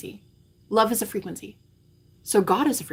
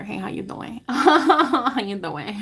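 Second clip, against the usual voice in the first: flirty pickup line; 4 semitones higher, slower than usual. Pretend.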